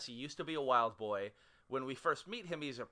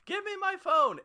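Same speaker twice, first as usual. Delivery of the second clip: high, loud imitation of a yelled demand; retold scream; 10 dB louder than usual. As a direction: mimic someone screaming from another room. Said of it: "she screams from the other room".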